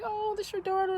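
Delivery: high-pitched imitation of the girls; sweet girls' greeting. Girls being introduced to the fan's daughter, high sweet imitation. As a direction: mimic a sweet reaction in a high voice.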